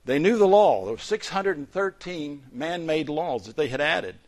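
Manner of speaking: loud, ringing sermon delivery; forceful preaching. Acted. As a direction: preach it out to the congregation.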